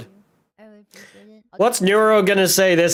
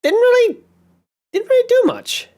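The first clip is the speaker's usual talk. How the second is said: cute anime streamer. Pretend. Squeaky reaction to VTuber clips.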